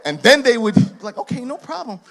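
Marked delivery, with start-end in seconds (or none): none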